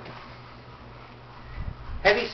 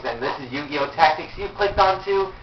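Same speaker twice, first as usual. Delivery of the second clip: usual speaking voice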